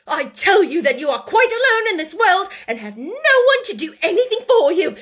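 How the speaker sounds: high, loud, exasperated scolding; sharp, angry tirade. A narrator's reading. Headmistress berates the silent orphan, sharply and angrily. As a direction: berate the child at full pitch. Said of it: "demanded Miss Minchin sharply".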